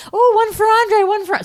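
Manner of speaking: high, loud singsong of the family doling out presents; mock cheery family. Family calling out whose gift is whose, high and cheerful. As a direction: cheerfully hand out gifts in a high voice. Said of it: "They're all like".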